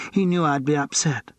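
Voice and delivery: tipsy, falling and slurred; slurred repeat. A narrator's reading. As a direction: say it again, drunker. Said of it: "her voice was slurred".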